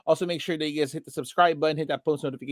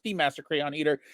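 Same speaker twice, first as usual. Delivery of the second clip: ordinary delivery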